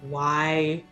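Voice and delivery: ordinary talk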